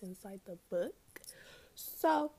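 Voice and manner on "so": a loud burp breaking into the word; burping after fizzy drink. Real, not acted. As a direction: burp mid-word, then carry on. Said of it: "I'm burping".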